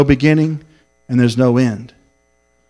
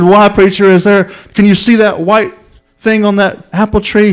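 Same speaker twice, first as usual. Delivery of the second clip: higher, louder acted eager questioning; anxious hope; 6 semitones higher, 4 dB louder than usual. Young man asks preacher about the apple tree, acted anxious hope. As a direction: imitate a young man urgently hoping for good news.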